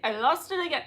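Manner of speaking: ordinary talk, nothing marked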